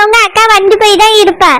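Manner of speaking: normal talking voice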